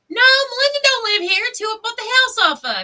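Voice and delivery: very high, loud, panicky denial; hammed-up frantic fib at full pitch; nervous wreck. Retold answer to sheriff at the door, panicky. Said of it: "I'm like a nervous wreck".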